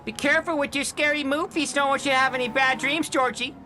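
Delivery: high, sweet granny voice; old lady voice. Acted. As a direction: coo it like a doting grandma. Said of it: "I'm trying to do an old lady voice".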